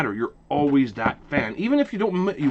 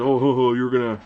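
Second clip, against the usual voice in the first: joking tease; 3 dB louder than usual. Retold or imitated. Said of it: "jokingly said".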